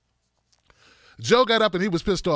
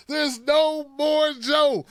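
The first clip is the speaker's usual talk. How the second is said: higher, slow, drawn-out knockout call; slow announcer call; 6 semitones higher, much slower than usual. Pretend. Ringside announcer declaring Joe finished, slow excited call. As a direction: stretch it out like a ring announcer.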